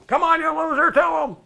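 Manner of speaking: high, loud crowd yell. Retold or imitated. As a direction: shout it like a hundred guys heckling. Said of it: "guys are yelling at him".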